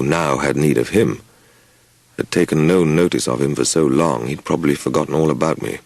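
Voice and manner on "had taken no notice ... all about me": plain reading voice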